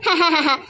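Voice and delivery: short ha-ha-ha; light dismissive chuckle; laugh. Acted. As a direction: laugh off the worry. Mother laughs at worry.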